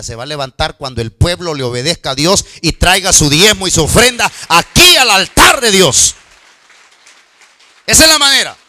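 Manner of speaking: preaching at full force. Real, not acted. Pastor urging congregation to tithe, preaching loud. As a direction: thunder it from the pulpit. Preaching against church sales.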